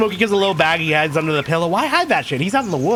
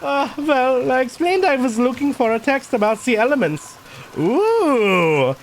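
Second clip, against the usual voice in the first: put-on higher-pitched German-accented character; 11 semitones higher, slower than usual. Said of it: "went for the German accent with this guy".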